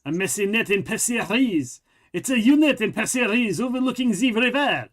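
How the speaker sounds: a light mock French accent; Steve Martin French accent. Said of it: "That's my Steve Martin doing a French accent".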